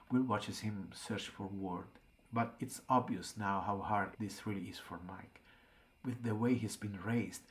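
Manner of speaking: plain reading voice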